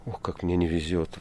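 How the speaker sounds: ordinary delivery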